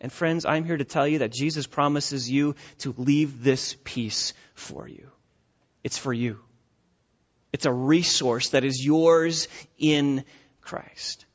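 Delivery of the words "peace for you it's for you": breathy hushed whisper. Acted. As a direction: drop to a near whisper on the promise. Whispered climax of promise.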